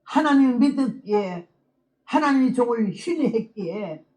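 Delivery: ordinary talk, nothing marked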